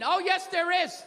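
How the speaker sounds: normal talking voice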